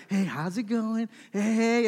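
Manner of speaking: lightly put-on voice for greeting a baby; a touch of silly grandparent talk; changed baby-talk voice. Shown as a demonstration. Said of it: "then you change your voice".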